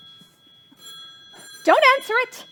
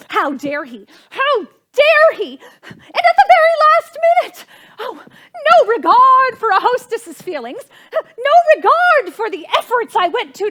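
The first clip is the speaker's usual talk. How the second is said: indignant outburst; 4 dB louder than usual. Acted. Hostess furious at the guest who cancelled, rising to a tearful cry. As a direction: work yourself up into tearful outrage.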